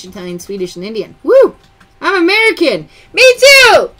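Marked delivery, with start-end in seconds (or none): 1.2-1.6 high, loud whoop; short excited woo; cheering woo. Real, not acted. Host cheers a viewer's mixed heritage, excited woo. 2.0-3.9 high, loud, jubilant shout; excited cheer. Real, not acted. Host agrees with a viewer's comment, cheering.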